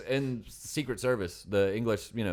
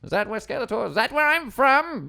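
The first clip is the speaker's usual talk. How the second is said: very high, loud villain whine; Skeletor voice; 8 dB louder than usual. Pretend. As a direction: keep the cartoon villain going. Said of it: "as Skeletor".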